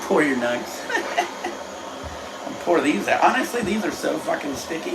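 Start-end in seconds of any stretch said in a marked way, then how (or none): 0.9-2.4 high, breath-only chuckle; amused laugh. Real, not acted. Host pouring out nugs, amused laugh. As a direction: laugh airily at your own slip.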